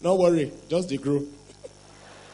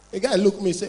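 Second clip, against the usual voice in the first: ordinary talk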